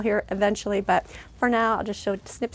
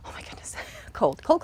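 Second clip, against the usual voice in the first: whisper. Retold or imitated. Shivering in the cold.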